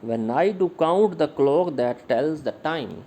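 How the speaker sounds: very slow measured verse, stressing I, count, clock, tells, time; iambic pentameter. Shown as a demonstration. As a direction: beat out "When I do count the clock" weak-strong. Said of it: "आयाम्बिक pentameter की line दी हुई है".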